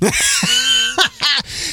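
a sudden piercing, very high laugh; shrieking laugh. Real, not acted. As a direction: let out a high squeal of laughter. Laughs at her sarcastic reply.

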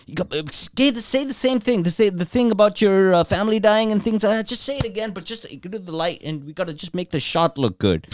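high, wheedling coaxing voice through laughter; mock pleading coax. Host coaxing refugee to retell story, high mock wheedle. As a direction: cajole him to repeat it, hammed up. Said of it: "He's like".

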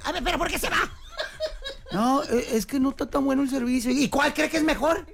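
call center agent. Pretend. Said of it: "Peleándome con la gente".

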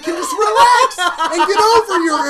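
high half-laughing rant; mock fury, laughing through it; mock outraged shout. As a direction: yell it in fake indignation.

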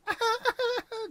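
high staccato wail mimicking a baby; high mock infant wail; imitated baby crying. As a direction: mimic a baby bursting into cries. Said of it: "you hear the baby".

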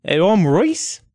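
casual, friendly character introduction; lizardfolk Reese. Acted. Reese meets armed kobolds, casual friendly voice. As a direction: introduce yourself as an easygoing lizardfolk.

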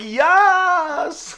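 high, loud delighted squeal; excited squeal. Retold or imitated. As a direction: shriek yes like a thrilled kid. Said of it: "Brittany was like / Exactly like that".

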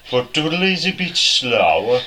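drawn-out Dudley accent. Shown as a demonstration. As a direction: stretch it out slowly in a Dudley accent. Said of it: "They're even slower than Birmingham's like that".

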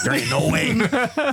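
slow high laughing no; laughing refusal. Retold or imitated. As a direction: refuse while chuckling. Refusing in retold talk, laughing.

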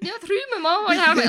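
put-on high, loud, crude Welsh accent; mock Welsh accent. Co-host imitates king claiming throne through his mother, exaggerated Welsh accent. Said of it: "he said in a Welsh accent".

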